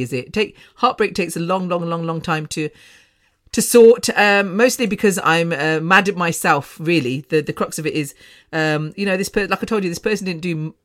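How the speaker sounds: normal talking voice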